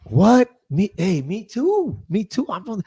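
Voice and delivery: put-on retelling his own eager reply to the girls; getting excited. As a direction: jump in thrilled that they share a hometown. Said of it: "and I get excited".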